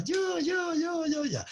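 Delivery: imitating kids answering the teacher; eager kids. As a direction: shout it like schoolkids raising hands. Kids answering in classroom roleplay.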